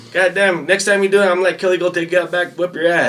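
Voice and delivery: high, loud protective bark; drill sergeant voice. Retold or imitated. Drill sergeant confronting another sergeant, high loud bark. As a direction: stand up for your private loudly.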